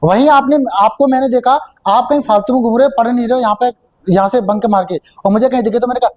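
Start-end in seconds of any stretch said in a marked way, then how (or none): none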